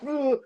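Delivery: high startled ugh; a frightened kid's yelp; scared groan. Retold or imitated. Six-year-old scared by the Exorcist, high yelp. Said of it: "I was just like".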